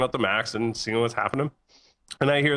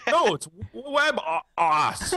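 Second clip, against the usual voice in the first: high, slow, laughing mock salesman insisting; imitating a know-it-all clerk, drawn out; 9 semitones higher, much slower than usual. Salesman insists on his wrong name, high mocking imitation. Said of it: "He goes".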